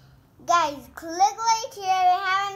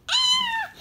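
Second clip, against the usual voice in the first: sharp, much higher and louder squeal; excited squeal; 7 dB louder than usual. Real, not acted. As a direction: shriek with pure delight. Kid thrilled at the unboxing.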